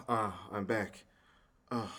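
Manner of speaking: normal talking voice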